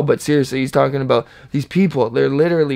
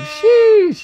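high-pitched, drawn-out sheesh; impressed exclamation; 4 dB louder than usual. Real, not acted. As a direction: squeal it in awe at a bar. Impressed by a killer bar.

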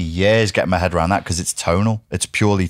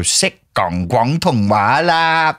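"Sit gong guang tung wa la" slow, high and loud, leaning hard into a put-on accent; overdone Chinese accent; 6 semitones higher, 3 dB louder, much slower than usual. Shown as a demonstration. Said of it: "So don't go ... that just sounds horrible".

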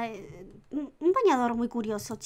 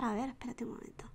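soft whisper while checking; whisper, focused on the screen; quiet whisper while concentrating; whispered to herself; 9 dB quieter, faster than usual. Real, not acted. Checking colours, talking to herself.

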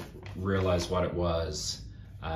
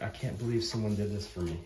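ordinary talk, nothing marked

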